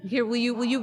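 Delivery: ordinary talk, nothing marked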